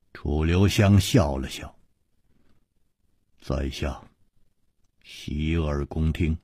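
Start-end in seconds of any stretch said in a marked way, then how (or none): 3.4-6.4 low, soft, polite invitation; soft-spoken courteous reply; courteous calm. A narrator's reading. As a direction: yield the floor gently, quiet and unhurried. Courteous smiling invitation to speak.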